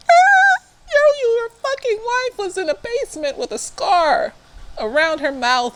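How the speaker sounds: high-pitched indignant outburst; outraged high exclaim. Real, not acted. Scolding the husband on the show, high and outraged. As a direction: squeal it in outrage.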